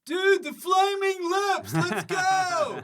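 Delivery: high, loud, slowed hype shout; mock festival-goer. Co-host mocking a punter leaving for the Flaming Lips, high shout. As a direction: yell like a punter off to another band.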